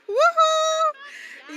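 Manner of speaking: a high, bright whoop of pride; joyful cheer. Real, not acted. Proud Jamaican nominee cheering for her homeland, excited whoop.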